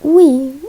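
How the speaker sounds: bright glided "Ui"; vowel demo. Shown as a demonstration. Teacher voicing the other vowel for students, clear i. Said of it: "usa bem o som do I".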